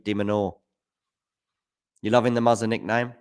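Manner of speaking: ordinary delivery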